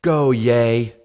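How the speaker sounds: a lightly put-on cheering fan; mock cheering fan voice. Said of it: "people will be like".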